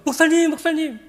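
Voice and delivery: high, loud eager call; imitated breathless-to-tell excitement; excited calling out. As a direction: call him, bursting with news. Woman phones pastor with good news.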